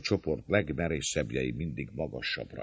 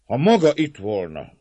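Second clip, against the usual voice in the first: high, loud, emphatic bursts; declamatory reply; 6 semitones higher, 9 dB louder than usual. A narrator's reading. Servant leader excusing surrender before everyone, declaiming. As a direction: declare your loyalty while giving in.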